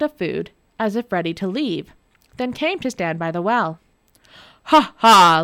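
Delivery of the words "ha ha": loud gloating ha ha; mocking demon laugh. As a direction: laugh in triumph. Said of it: "laughed the demon".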